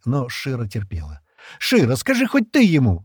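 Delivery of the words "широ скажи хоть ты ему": high, loud exasperated appeal; insistent. A narrator's reading. Джема appeals to her friend Широ, insistent. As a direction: plead for backup, pushing hard. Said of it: "настаивала Джема".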